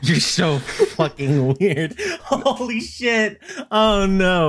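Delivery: high-pitched laughing exclamations, drawn out; helpless laughter squeezing the words high; cracking up. Real, not acted. Cracking up at the other speaker.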